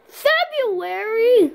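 high, very slow drawn-out shocked cry; surprised. Acted. Reading the calendar, slow high astonishment. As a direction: stretch it out in disbelief.

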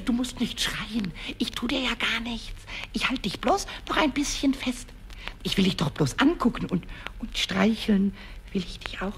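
soft, low reassurance; calm a frightened little creature; gentle soothing. Acted. Pumuckl comforts the mouse he holds, soft and soothing.

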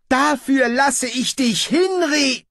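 high, loud, slow threat cut short; pompous ruler threatening, breaking off mid-word; outraged threat. Acted. (empört drohend)

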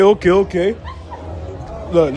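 ordinary talk

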